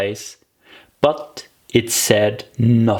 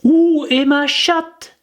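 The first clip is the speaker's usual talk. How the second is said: much higher, slow, careful French; schoolgirl French; slower than usual. Acted. Alice trying French on the mouse, high and careful. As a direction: recite it like a lesson-book line. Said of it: "first sentence in her French lesson book".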